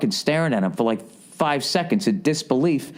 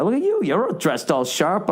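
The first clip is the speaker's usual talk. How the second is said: higher, surprised "look at you"; impressed tease; 7 semitones higher than usual. Retold or imitated. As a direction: playfully admire his sharp suit. Teasing compliment to stranger.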